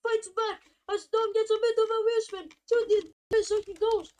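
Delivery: loud, fast play-by-play; excited. Real, not acted. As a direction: call the overtake at full pitch. Fight for P one, live call.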